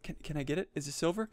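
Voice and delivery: ordinary talk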